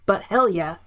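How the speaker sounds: usual speaking voice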